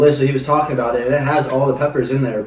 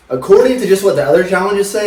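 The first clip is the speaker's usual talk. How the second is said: ordinary delivery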